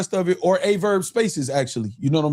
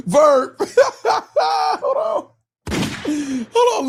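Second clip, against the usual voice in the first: cracking up, trying to say hold on; slower than usual. Real, not acted. Host cracking up at Verb's clip, shouting through laughs.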